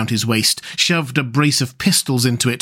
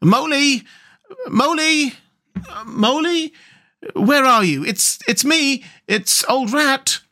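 high sing-out call, drawn out; high, slow hallooing without shouting; cheerful calling; 13 semitones higher, slower than usual. A narrator's reading. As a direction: call cheerfully into the distance for a lost friend. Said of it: "calling out cheerfully".

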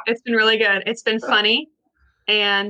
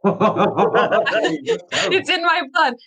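laughing; 4 semitones higher, much slower than usual. Real, not acted.

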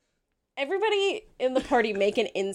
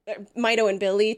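normal talking voice

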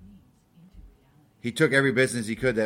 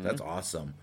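normal talking voice

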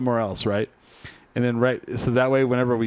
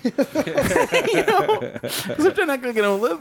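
laughing; 13 semitones higher, much slower than usual. Real, not acted. Laughing at his own lie.

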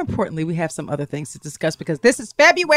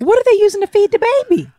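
shocked disbelief; 4 dB louder, faster than usual. Real, not acted. Shocked at the unwashed bottles.

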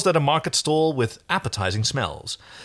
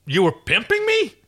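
high, outraged question, mildly raised voice; roared indignantly; 12 semitones higher, 4 dB louder than usual. Acted. John accuses Aaron of pimping him, indignant. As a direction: demand it in indignation. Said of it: "John Albert roared indignantly".

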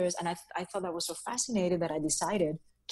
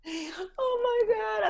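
mock despair; 12 semitones higher, much slower than usual. Real, not acted. Embarrassed wail.

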